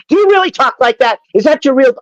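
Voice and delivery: high, quick mock disbelief; incredulous. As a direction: question him fast and high, imitating the auditioner. Acted incredulous game show auditioner.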